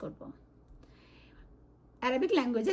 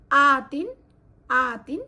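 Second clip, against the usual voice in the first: আতিন said loud and sharp; emphasised ending; 7 dB louder, much slower than usual. Shown as a demonstration. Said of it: "মানে হচ্ছে feminine নাসব and জার". (জোরে আতিন উচ্চারণ)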